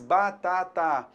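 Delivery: "batata" drawn out slowly, three full vowels; syllable by syllable. Shown as a demonstration. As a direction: stretch "batata" into ba, ta, ta. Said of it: "eu tenho três vogais".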